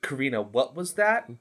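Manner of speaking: ordinary talk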